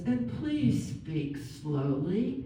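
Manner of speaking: very slow, kind voicemail request; kindly. Retold or imitated. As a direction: imitate a kind greeting, drawing out each word. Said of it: "And then very kindly".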